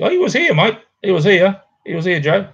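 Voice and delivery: eager, high-pitched "he was here" to chat; excited protest. Real, not acted. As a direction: exclaim that he really was here. Protesting the missing guest was there.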